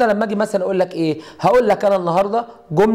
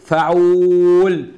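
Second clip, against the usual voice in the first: فعول stretched very slowly and a bit louder; slow pattern drill; 4 dB louder, much slower than usual. Shown as a demonstration. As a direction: draw out فعول for the class. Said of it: "اقول ايه؟".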